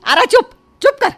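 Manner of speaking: high, loud shushing; mother-in-law sharply silencing her daughter; sharp hush. Acted.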